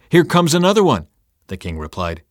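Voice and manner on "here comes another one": higher, louder call; shouting down. A narrator's reading. Watchman reports a runner to the king, shouting down. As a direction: call out a sighting. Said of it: "He shouted down".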